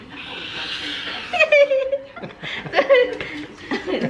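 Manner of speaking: very high squealing laugh; long, helpless high giggle; squealing laughter. Real, not acted. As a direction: shriek with laughter. Horsing around with partner.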